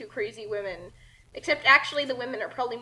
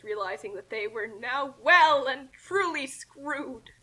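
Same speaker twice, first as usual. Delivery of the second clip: plain reading voice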